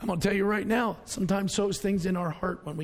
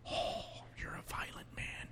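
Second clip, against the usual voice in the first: very soft, airless whisper; 16 dB quieter than usual. Retold or imitated. Preacher voicing God's reply to him in a whisper. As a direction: breathe the reply as a secret.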